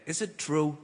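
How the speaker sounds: a touch of quiet American retail worker; shop guy. Acted. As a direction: ask softly as a curious store guy. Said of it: "he said".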